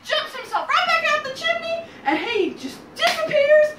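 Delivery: high, loud storytelling; excited climax narration. A narrator's reading. Storyteller as the wolf flees the chimney, excited and loud. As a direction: keep it high, loud and thrilled.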